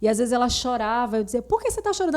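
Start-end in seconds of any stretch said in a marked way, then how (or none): 1.4-2.2 mother's question. Retold or imitated. Mother asking crying daughter.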